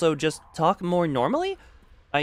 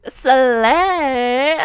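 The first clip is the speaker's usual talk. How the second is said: put-on higher, louder valley girl Slay with a rise; 6 semitones higher, 6 dB louder than usual. Shown as a demonstration. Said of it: "Hear the voice".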